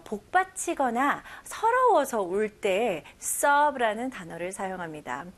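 ordinary delivery

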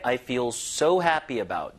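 a little extra force on "so"; stress on so. Shown as a demonstration. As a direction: press on "so", then ease down through the rest. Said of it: "여기다 조금 더 힘을 주는 게". ("so"에 조금 더 힘주어)

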